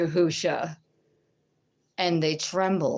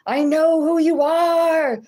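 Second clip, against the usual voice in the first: far higher and louder, slow piercing cry; eerie possessed voice; 10 semitones higher, 8 dB louder, slower than usual. Retold or imitated. The manifesting woman confronting her in the aisle, piercing and high. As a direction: let the woman's voice spike, unnatural.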